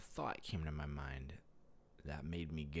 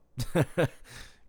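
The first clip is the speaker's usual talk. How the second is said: high burst of laughter; giggle; 11 semitones higher, 13 dB louder than usual. Real, not acted. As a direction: laugh out suddenly. Giggling at thought.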